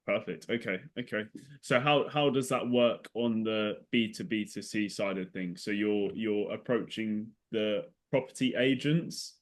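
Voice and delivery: ordinary talk, nothing marked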